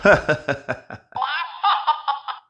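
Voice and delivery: long, high put-on laugh; demonstration laugh. Shown as a demonstration. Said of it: "Can you laugh?".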